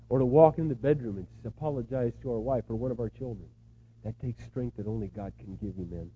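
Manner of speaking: usual speaking voice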